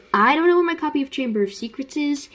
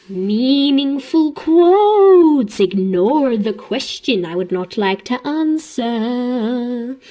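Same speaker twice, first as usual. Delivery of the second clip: mock meaningful quote; 4 dB louder, much slower than usual. Fan mocking the headmaster dodging questions, mock meaningful quote. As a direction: stretch it into empty grand wisdom. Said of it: "making it sound like a meaningful quote".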